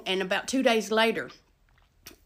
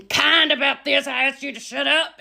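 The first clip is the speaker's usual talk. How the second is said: loud, raised-pitch telling-off; acted scolding; 4 semitones higher, 5 dB louder than usual. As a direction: scold her as you wrestle her, acting it out. Acts out scolding during the headlock.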